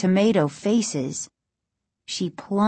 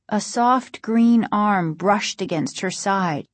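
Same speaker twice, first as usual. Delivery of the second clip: usual speaking voice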